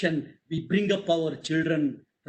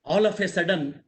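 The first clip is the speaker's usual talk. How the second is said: usual speaking voice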